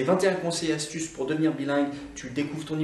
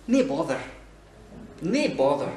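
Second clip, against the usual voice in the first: Scottish "nae bother"; Scottish accent. Shown as a demonstration. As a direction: reassure like a Scot. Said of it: "typiquement écossaises". (accent écossais)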